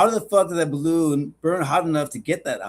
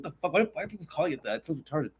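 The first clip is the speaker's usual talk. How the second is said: muttered disbelief; 2 semitones lower, 8 dB quieter, faster than usual. Retold or imitated. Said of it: "I was like".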